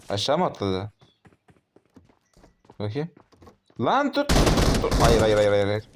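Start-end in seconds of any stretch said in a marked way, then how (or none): 3.8-4.9 sudden high yelp, much louder; panicked yell. Real, not acted. Panicked firefight. 5.0-5.9 loud rapid protest, louder; panicked no. Real, not acted. Getting shot, panicking.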